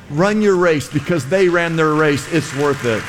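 ordinary talk

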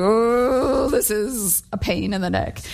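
drawn-out groan sinking into a mock grumble; grumbling under breath. Reluctant host complaining about guests, grumbling under breath. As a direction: grumble reluctantly, starting on a long "oh". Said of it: "under my breath going".

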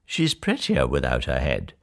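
low, soft dry remark; smugly pleased. A narrator's reading. Prince admires the beheaded sister, quietly pleased. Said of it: "He smiled and said".

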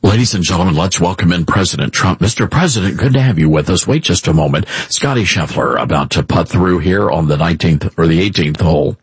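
low, hushed-style golf commentator; mock golf broadcast voice, pitched well down. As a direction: call the putt in a low, measured murmur. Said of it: "talk in a golf voice".

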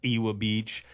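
Ewa said as EE-wuh in Ewa Beach; outsider pronunciation. Retold or imitated. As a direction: keep a w sound in Ewa. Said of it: "you would hear, like".

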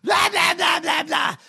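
rising mock shout of blah blah; scornful hammed-up blah blah. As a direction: ridicule a dull speech by yelling blah. Mocking boring Democrat speech.

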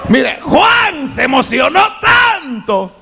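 rising, shouted sermon cadence; fiery preaching. Acted. Preacher crying out to the church about the wedding feast. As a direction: preach it out to the congregation.

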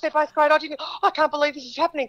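overwhelmed, tumbling over his words; excited friend. Retold or imitated. Friend torn between two song versions, excited gush. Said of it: "He goes".

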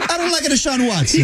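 high laughing Italian fan voice; hammed-up Italian football fan; mock Italian accent.

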